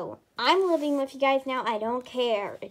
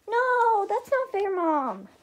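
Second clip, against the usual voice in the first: whiny protest; 9 semitones higher, 4 dB louder, slower than usual. Acted. Kid protests to mom.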